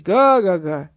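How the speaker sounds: loud booming ha-ha-ha; hearty guffaw. A narrator's reading. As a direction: laugh big at your own joke. Big man laughs at own joke.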